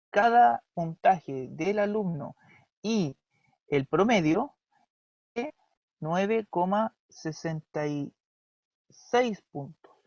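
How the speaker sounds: slow, spelling out each number; careful slow read-out; slow dictation. Shown as a demonstration. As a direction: lay out the figure word by word, slowly. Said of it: "Entonces yo tendría que decir así".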